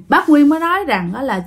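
ordinary delivery, nothing marked

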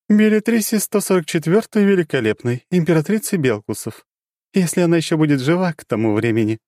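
high, grim greeting; dark goddess turning grave; frowning goddess. Acted. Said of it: "богиня нахмурилась".

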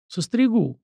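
mildly sheepish, high then falling; embarrassed promise. A narrator's reading. Grandson promising grandfather to cut his hair, mildly embarrassed. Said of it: "смущённо пообещал Сашка".

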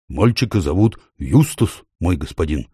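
plain reading voice

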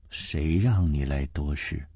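low, quiet, stern reproach; stern rebuke. A narrator's reading. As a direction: scold coldly under your breath. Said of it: "他厉声地说".

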